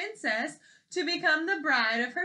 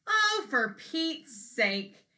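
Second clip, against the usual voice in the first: exasperated sigh; 4 semitones higher, much slower than usual. Acted. Paulina exasperated at pea trick.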